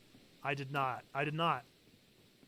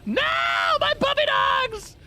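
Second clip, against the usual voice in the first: high, slow hammed-up wail; slow high mock lament; 5 dB louder, slower than usual. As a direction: cry out for the puppies, melodramatic. Mock wailing over lost puppies.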